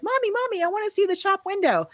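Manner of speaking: put-on high little kid begging voice; child's voice. Toddler begging mom to see the shop window, high kid voice. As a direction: pipe up high like a small child tugging at mom. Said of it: "and the toddler's like".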